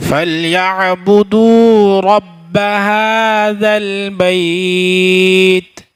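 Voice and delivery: slow melodic tajweed recitation; Quran recitation. Acted. Teacher reciting the verse to students in slow melodic chant.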